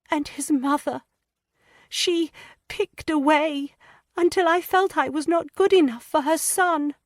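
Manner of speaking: put-on high, hesitant girlish voice, stammering; a frightened young wife struggling to confess; young distressed woman. A narrator's reading. Lydia hesitantly confiding her fears to Anne.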